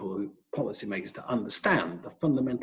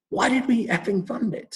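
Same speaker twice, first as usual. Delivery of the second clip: retold furious outburst; 10 semitones higher, 4 dB louder than usual. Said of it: "And he started swearing".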